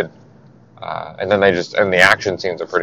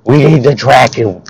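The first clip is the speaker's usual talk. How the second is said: higher-pitched bad Brooklyn accent; Prince Zuko, Brooklyn accent; 4 semitones higher than usual. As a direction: do an urgent cartoon prince in shoddy Brooklynese. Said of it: "kinda giving him a shitty Brooklyn accent".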